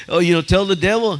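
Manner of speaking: ordinary delivery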